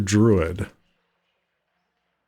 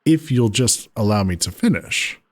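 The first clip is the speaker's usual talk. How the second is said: ordinary delivery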